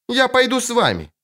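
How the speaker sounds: put-on high-pitched childlike announcement; little girl's voice. A narrator's reading. Girl agrees to join witcher and dryad, childlike. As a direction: pipe up as the young princess.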